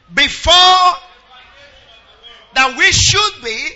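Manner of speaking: reading the verses out loud in an unhurried preaching cadence; proclaiming scripture over the music. Acted. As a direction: lead the church through the verses, full voice. Leads loud scripture reading again.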